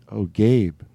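ordinary talk

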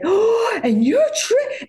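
very high, slowed, crying squeal; stunned fan; tearful starstruck. Retold or imitated. Actress meeting Tracy Brightman, squealing through tears.

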